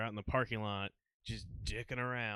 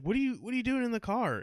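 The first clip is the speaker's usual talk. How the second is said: baffled cop questioning; 12 semitones higher, 6 dB louder, faster than usual. Retold or imitated. Cop questions friend in car.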